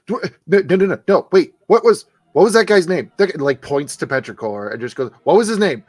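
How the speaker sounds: high, loud, stammering panic; scrambling shrill for a name he can't recall; flustered thug shouting over himself; frantic Guzman. Acted.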